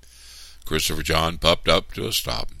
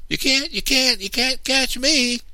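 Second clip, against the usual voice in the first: high, louder singsong taunt; a girl taunting her brother in a chase; 6 dB louder than usual. A narrator's reading. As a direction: tease him while running away. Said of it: "I taunted".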